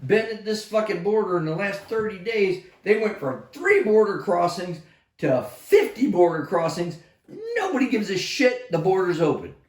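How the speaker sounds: high, loud, fast cursing rant; angry rant. Retold or imitated. Soldier ranting that nobody cares about the border, high loud angry rant. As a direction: blast it out with full disgust. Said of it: "I said".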